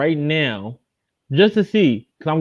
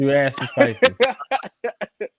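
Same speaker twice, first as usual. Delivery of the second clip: laughing; much slower than usual. Real, not acted. Laughing after the burn.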